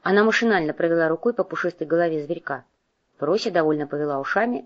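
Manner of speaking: ordinary delivery, nothing marked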